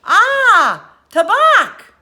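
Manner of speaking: loud, high French call; a French fisherman shouting back from his boat. A narrator's reading. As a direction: shout back across the waves. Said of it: "The Frenchman called back".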